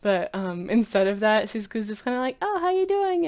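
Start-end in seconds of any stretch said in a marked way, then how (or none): 2.4-3.3 high, bright-voiced breezy greeting; carefree greeting. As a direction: toss it off lightly, unbothered. Carefree character voiced in recap.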